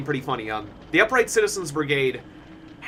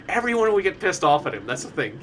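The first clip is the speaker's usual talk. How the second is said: normal talking voice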